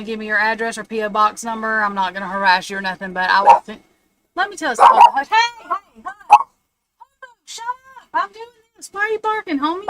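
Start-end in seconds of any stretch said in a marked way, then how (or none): 4.3-6.5 high, loud hushing of a barking dog; real exasperated shushing, pitched way up; scolding a dog. Real, not acted. 7.4-9.8 high-pitched telling-off of the dog; exasperated high voice for a barking dog; scolding a dog. Real, not acted.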